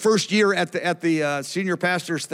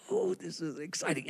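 much softer, awed aside; hushed excitement; 12 dB quieter than usual. Retold or imitated. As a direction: marvel under your voice. Speaker's hushed reaction on hearing testimonies.

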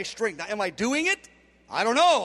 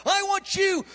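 emphatic cry; 9 semitones higher than usual. Retold or imitated. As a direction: call it out like God's plea, raised and high. God demands the heart.

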